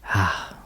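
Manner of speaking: short, relieved "ah" on an outbreath; demonstrated sigh of relief. Shown as a demonstration. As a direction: let go with an easy sigh. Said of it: "Notice the sense of relief".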